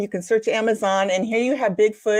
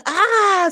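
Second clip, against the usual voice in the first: high, loud mock scream; egg's scream; 10 semitones higher, 4 dB louder than usual. A narrator's reading. Telling the egg joke meme, the egg suddenly screams. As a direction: let the egg cry out in sudden pain, pitched up. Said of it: "screams the left egg".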